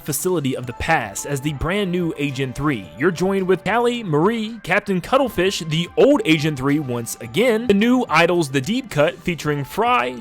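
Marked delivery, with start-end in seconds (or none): none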